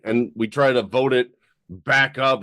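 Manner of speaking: ordinary delivery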